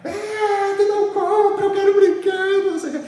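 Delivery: put-on high, wailing child's cry. Child crying at dad who won't buy, high mock wail. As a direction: wail like a spoiled kid crying for a toy. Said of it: "Ela começa a chorar".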